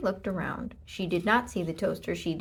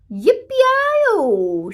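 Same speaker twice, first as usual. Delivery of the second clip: very loud, high cowboy yell; shouted yippee; 14 semitones higher, 13 dB louder than usual. A narrator's reading. Thief rides the galloping pig out the door, shouted yippee. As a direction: whoop like a rodeo rider. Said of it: "shouted Leroy Ninker".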